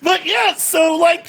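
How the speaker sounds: usual speaking voice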